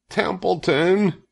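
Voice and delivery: high, drawn-out pleading name; desperate. A narrator's reading. Wilbur begs the idle rat, desperate. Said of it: "said Wilbur in desperation".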